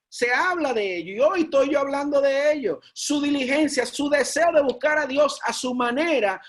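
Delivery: rising sermon fervor; fiery preaching. Real, not acted. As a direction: preach it out to the congregation. Preaching builds to full force.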